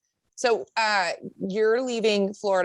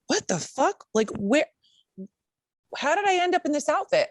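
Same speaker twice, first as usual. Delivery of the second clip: high, baffled disbelief; high, bewildered hungover self; confused shock; 10 semitones higher than usual. Retold or imitated. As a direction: squeak out the confusion. Waking up in a onesie.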